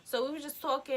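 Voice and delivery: usual speaking voice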